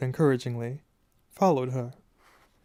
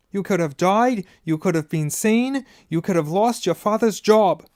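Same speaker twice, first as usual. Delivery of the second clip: loud, higher shouted scolding; furious shouting; 6 semitones higher, 6 dB louder than usual. A narrator's reading. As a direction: hammer each accusation at them. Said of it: "shouted herself hoarse".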